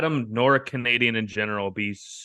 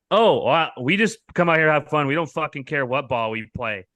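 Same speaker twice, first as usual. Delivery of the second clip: higher, louder mock breeziness; mimicked dismissive woman; 6 semitones higher, 5 dB louder than usual. Relaying her response about the ball, mock breezy. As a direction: imitate her brushing it off.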